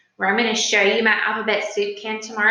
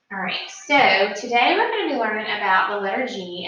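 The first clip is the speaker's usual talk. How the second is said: ordinary talk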